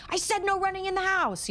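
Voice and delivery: high, sharp mock scolding; angry parent. Parent scolding a child who made a mess, mock angry. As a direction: bark at the kid like an angry parent.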